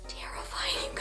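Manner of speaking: high whispered aside to viewers; hushed, wide-eyed whisper; dramatic whisper. Acted. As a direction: lean in and whisper it.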